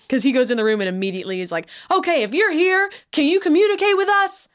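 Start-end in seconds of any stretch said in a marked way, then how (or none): 1.9-4.5 mock yelling. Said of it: "can you please stop yelling".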